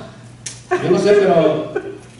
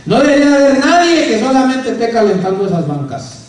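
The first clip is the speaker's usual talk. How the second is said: fervent preaching; 5 semitones higher, 5 dB louder than usual. Acted. Preacher urging the congregation to serve God, fervent and loud. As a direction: drive the verse home to the congregation.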